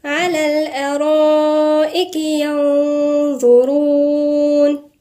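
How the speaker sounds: slow, melodic tajweed chant; measured Quranic recitation. Acted. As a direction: recite the verse slowly, drawing out the vowels. Recites the verse.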